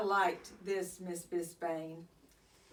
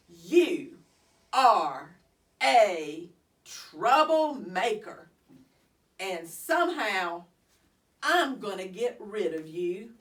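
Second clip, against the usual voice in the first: angry threat; 8 dB louder than usual. Acted. Scary teacher threatening the hamster, loud and angry. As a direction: bite off each word at the hamster. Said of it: "with angry eyes and said".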